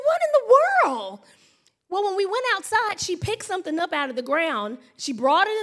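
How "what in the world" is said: high, loud, drawn-out mock alarm; astonished cry. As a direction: cry out aghast, stretching the words. Retold alarm at finding dog choking.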